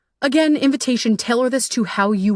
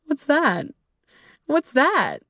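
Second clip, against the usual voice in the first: mock clueless; 6 semitones higher, much slower than usual. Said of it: "And they're like".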